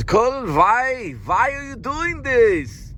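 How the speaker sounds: high, slow put-on German accent; a hammy Austrian-sounding plea; bad Herzog that drifts into Schwarzenegger; mock Herzog accent. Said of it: "This sounds more like Arnold Schwarzenegger".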